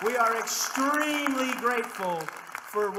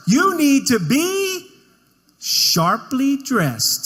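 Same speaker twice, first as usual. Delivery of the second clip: very loud, slow and drawn-out; big mock proclamation; 4 semitones higher, 12 dB louder, much slower than usual. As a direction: blast out the tip, stretching each word. Comic tip delivered as big punchline.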